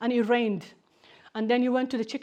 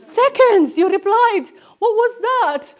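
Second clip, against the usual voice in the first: much higher and louder, laughing as she teases; laughing triumph; 12 semitones higher, 13 dB louder than usual. Retold or imitated. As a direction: gloat through laughter at the quick reply. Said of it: "And I'm like".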